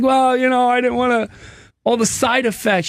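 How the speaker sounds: a mild imitation of a hesitant wife; wife's reluctant voice. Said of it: "She's like".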